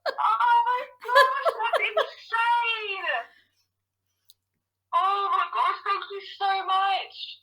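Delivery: high, drawn-out tearful disbelief; crying with joy. Real, not acted. Winner taking in first prize on the phone, crying happily. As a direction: laugh and cry at once, overwhelmed. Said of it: "I can hear her crying away".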